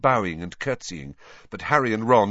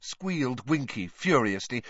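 plain reading voice

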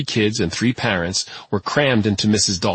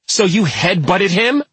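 slow, bitter accusation, pitched higher; 11 semitones higher, 4 dB louder than usual. A narrator's reading. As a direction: throw the question at him with bitterness. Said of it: "Tate's mom said bitterly".

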